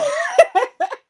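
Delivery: real loud laugh; loud high laugh. Real, not acted. Laughing at own story.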